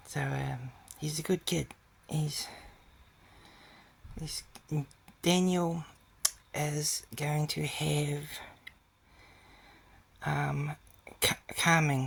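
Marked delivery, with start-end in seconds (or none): none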